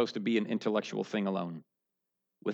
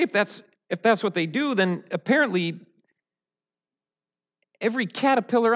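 preaching; 9 semitones higher, 5 dB louder than usual. Acted. Impassioned preaching, rising force.

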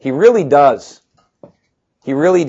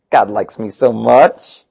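smug mock boast; 4 semitones lower, slower than usual. Mocking entitled believer.

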